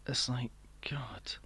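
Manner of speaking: slow whispered exasperation. Real, not acted. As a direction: breathe it out despairingly. Exasperated aside.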